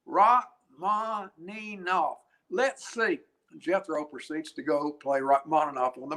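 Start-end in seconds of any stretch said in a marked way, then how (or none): 0.1-3.2 high, slow sounding-out in East Tennessee twang; fake East Tennessee accent. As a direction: puzzle it out slowly as an East Tennessee hillbilly. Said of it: "in this fake East Tennessee accent".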